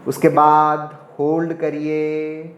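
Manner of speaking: pace each instruction and number to a steady breath; guided meditation. Shown as a demonstration. Yoga teacher counting viewers through inhale, hold and exhale, guided meditation. Leads a timed breathing exercise.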